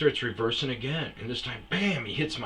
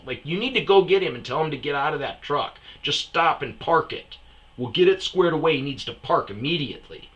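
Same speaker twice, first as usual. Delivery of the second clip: loud, raised, exasperated telling-off; retold angry demand; 6 semitones higher, 8 dB louder than usual. Telling the wife her husband must park, loud exasperation. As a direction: lay down the law, loudly.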